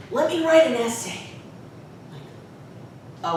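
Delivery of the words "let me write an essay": lightly imitated kid, higher pitched; youthful childlike student. Student offering to make amends, lightly childlike and eager. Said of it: "childlike way of speaking".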